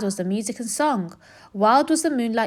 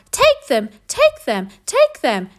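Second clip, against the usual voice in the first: much higher, louder echoing call; cave echo; 7 semitones higher, 6 dB louder, slower than usual. A narrator's reading. Echo luring the rat into the cave, high and loud. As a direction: ring it back like an echo. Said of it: "came back the welcome sound".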